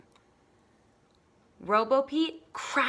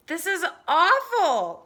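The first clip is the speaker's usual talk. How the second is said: slow, high, loud complaining whine; high whiny cat; 8 semitones higher, 9 dB louder than usual. Acted. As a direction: wail it like a fed-up cat. Pete hates the noise.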